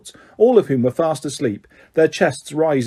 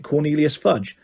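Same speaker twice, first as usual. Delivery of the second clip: ordinary delivery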